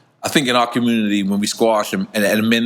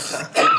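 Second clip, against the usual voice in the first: squealing laugh. Real, not acted. Reaction to the shot.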